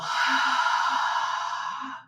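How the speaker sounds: airy, voiceless sigh out; long audible exhale. Shown as a demonstration. Yoga teacher breathes out along with students, long exhale. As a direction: let all the breath go in one long sigh.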